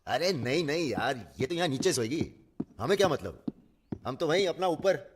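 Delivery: high, hasty disowning; flustered protest. Acted. Man refusing to share upstairs with the woman, flustered protest. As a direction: wriggle out of it, pitch jumping up.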